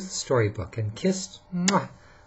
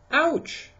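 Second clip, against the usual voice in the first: high little yelp; Little Bear's ouch. Acted. Little Bear bumps his head and yelps high. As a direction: yelp it high like a small cub. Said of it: "said Little Bear".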